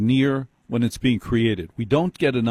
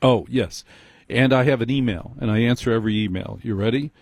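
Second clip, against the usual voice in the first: usual speaking voice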